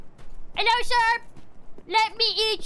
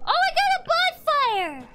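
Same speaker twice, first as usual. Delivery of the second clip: excited; 9 semitones higher than usual. Real, not acted. Finds bonfire.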